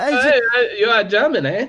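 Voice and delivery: high, loud mock German accent. Playing a stranger asking if he is German, mock German accent. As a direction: pipe up in a hammed-up German accent. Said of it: "German accents".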